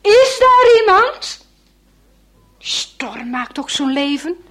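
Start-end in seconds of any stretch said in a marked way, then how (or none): 0.0-1.4 calling out much higher and louder, slower. Acted. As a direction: shout it into the storm, drawn out. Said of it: "hij roept nog maar eens".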